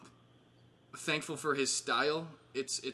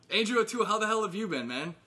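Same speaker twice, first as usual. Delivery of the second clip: excited greeting; 8 semitones higher, 5 dB louder, faster than usual. Real, not acted. Loudly greeting a friend on call.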